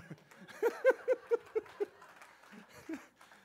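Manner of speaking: big high laugh; real hearty laughter; high loud laugh. Real, not acted. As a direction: burst out laughing. Preacher cackles at own joke.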